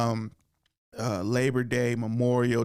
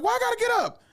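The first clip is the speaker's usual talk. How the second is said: much higher, whiny complaint; mock kid protest. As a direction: squeal it like a wronged kid. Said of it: "I was like".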